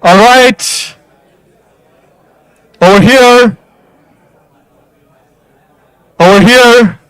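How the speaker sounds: high, loud call across the room. Real, not acted. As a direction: call the crowd over from afar. Host calling crowd over, raised voice.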